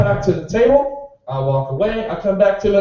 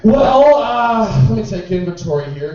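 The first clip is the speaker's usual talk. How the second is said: mock sarcastic bartender; 4 semitones higher, 6 dB louder than usual. Sarcastic bartender reply to customer.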